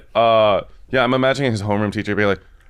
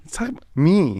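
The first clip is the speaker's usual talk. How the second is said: mock fan voice; 5 semitones higher than usual. Mock fan voice, self-centered.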